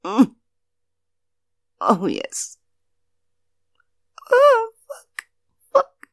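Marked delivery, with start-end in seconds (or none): 4.3-6.1 much higher, loud, breaking cry; loud high exclamation. Acted. Woman at the peak with her lover, loud high cry. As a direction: burst out at the peak.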